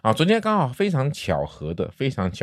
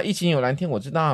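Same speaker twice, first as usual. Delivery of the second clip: ordinary talk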